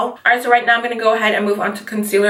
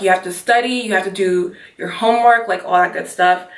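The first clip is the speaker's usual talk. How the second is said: normal talking voice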